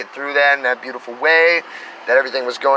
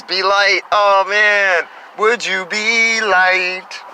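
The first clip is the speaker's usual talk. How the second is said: message voice; 6 semitones higher, much slower than usual. Retold or imitated. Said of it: "It says".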